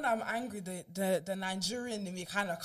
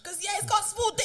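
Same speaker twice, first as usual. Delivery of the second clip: mock threat; 10 semitones higher, 3 dB louder than usual. Acted Clubhouse threats.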